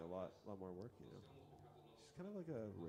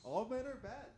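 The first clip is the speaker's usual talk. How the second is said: loud mock; 13 semitones higher, 6 dB louder than usual. Repeating the line, loud.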